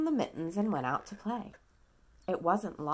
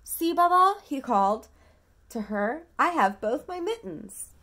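put-on louder, higher child calling; 6 semitones higher, 7 dB louder than usual. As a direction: call proudly across to grandma. Nicky calls to his grandmother.

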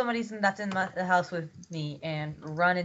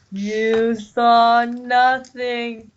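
mock menace; 5 semitones higher, 8 dB louder, much slower than usual. Slow menacing repeat, denying fire.

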